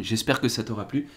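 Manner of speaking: normal talking voice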